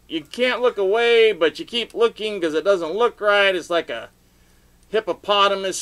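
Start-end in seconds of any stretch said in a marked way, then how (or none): none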